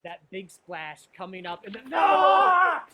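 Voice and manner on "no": sudden high yell of protest; startled shout. Real, not acted. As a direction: cry out as you're shoved. Pushed into the water.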